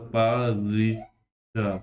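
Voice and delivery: परीक्षा drawn out slowly, pa-ree-ksha; slow spelling demo. Shown as a demonstration. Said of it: "ऐसे नहीं लिखा जाता".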